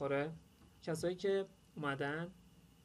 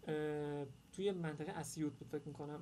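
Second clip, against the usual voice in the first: usual speaking voice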